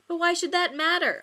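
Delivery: high, loud desperate argument; loud desperate plea to a hesitant lover. Acted. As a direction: plead with everything. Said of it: "argued Joey desperately".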